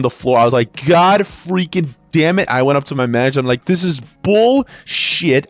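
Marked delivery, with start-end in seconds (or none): 0.9-2.5 slow, higher-pitched exasperated curse; retold angry outburst. Clerk slamming a pencil down, exasperated. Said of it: "I literally slammed my freaking pencil". 3.7-5.5 drawn-out, higher indignant protest; retold anger. Clerk complaining to his manager, indignant. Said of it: "I got mad".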